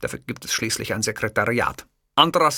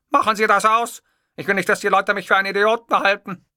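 indignant; 9 semitones higher, 5 dB louder than usual. A narrator's reading. Boss objects to the silly ringtone.

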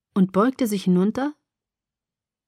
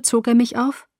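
usual speaking voice

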